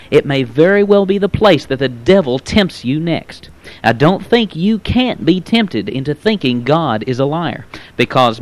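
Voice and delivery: usual speaking voice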